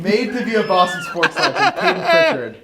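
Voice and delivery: laughing, high and loud; cracking up while hyping him. Real, not acted. Laughing hype.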